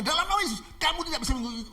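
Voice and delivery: high-pitched firm invocation; commanding. Acted. Pastor forbidding robber to follow, commanding. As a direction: declare it as an order.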